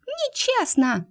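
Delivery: high, slow squealing protest; outraged mouse yell. Acted. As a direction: wail it out, high and drawn. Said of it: "заорал Кыш".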